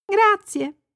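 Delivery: put-on high little girl's thanks; grateful little girl. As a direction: thank him as a child, pitched right up. Bambina ringrazia il vecchietto. (voce acuta da bambina grata)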